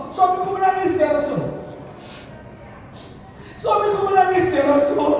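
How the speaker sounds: high wailing lament; distressed widow. Acted. Wife tells the Prophet her husband wept, high distressed lament. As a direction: wail it high, falling on the last words.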